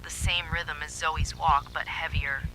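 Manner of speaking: plain reading voice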